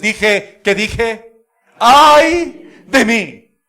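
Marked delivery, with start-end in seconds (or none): 1.8-3.6 loud, drawn-out cry of woe; slow, loud cry of despair; anguished cry. Acted. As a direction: stretch it out as a loud lament. Isaiah's cry before God.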